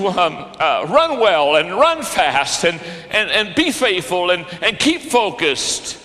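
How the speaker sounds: fervent preaching, pressing the congregation to pass the baton; preaching at full force. Acted. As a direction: drive it home like a pulpit climax. Preacher builds to full-force exhortation.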